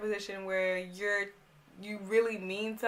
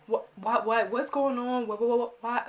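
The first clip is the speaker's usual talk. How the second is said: stumbling, repeated "what"; imitated stammer, unable to get going; panicked stutter; 3 semitones higher than usual. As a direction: trip over the word in confusion. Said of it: "Like, I'm stuttering".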